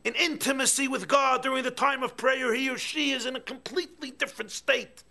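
loud, high fervent sermon voice; impassioned preaching. Acted. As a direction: preach with burning longing.

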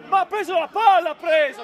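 loud, insistent objection; protesting shout. Real, not acted. Player keeps disputing the call, shouting in protest.

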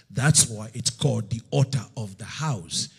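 usual speaking voice

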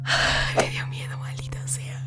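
breathless scared whisper. Real, not acted. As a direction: whisper it, still spooked.

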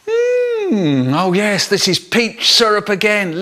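high, long mmm of delight; gleeful relish. Acted. Boy finding peach syrup again, gleeful. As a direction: savour the lucky find.

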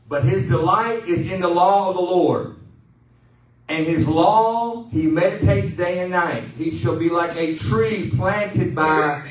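loud, slow, ringing scripture read; preached scripture reading. Acted. Pastor reading Psalm one to the congregation, loud and proclaiming. Reads Psalm aloud in full force.

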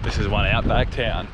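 ordinary talk, nothing marked

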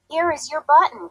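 a lightly voiced happy cry; excited cry. A narrator's reading. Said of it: "cried Frog".

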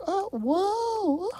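high startled cry as he falls; falling yelp. Acted. Falling hero cries out in high alarm. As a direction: yelp while tumbling from the sky.

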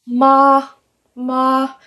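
MA held high and perfectly level; straight-across high monotone on MA; first tone. Shown as a demonstration. Teacher showing learners the even first tone, level and high. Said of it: "straight across, like a monotone, very even".

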